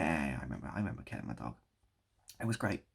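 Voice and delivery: ordinary talk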